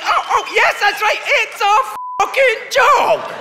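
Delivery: high, loud gleeful shout; excited Canadian. Retold or imitated. Canadians cheering that it's a joke, high excited shout. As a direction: squeal it out in delight. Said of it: "Canadians are like".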